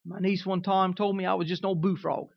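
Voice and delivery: ordinary talk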